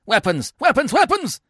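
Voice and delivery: high, loud, rising alarm; crying for weapons. A narrator's reading. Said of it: "The senator cries for weapons".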